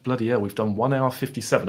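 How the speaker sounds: usual speaking voice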